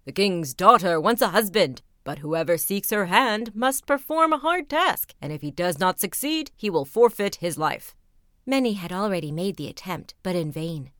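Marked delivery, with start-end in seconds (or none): 0.0-8.0 loud proclamation to the crowd; herald crying aloud. A narrator's reading. A rider proclaims to the crowd, loudly. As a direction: call it out across the street. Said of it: "crying aloud".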